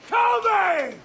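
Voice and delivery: high defiant taunting, ending in a shout. Acted. Prisoner goading the captain, rising to a shout. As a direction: dare him to arrest you.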